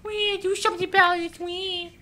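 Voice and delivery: put-on high, wailing baby whine; mock crybaby. Gamer mocking an upset opponent, high crybaby wail. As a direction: mimic a bawling crybaby.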